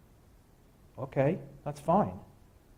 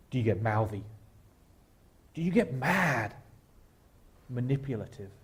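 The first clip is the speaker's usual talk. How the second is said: ordinary delivery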